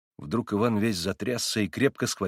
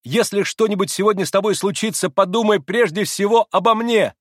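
calling out; 10 semitones higher, 8 dB louder than usual. Acted. Calling after departing brother.

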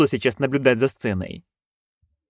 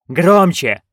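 louder command; 4 semitones higher, 5 dB louder than usual. A narrator's reading. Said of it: "director demands louder".

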